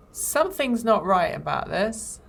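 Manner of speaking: ordinary delivery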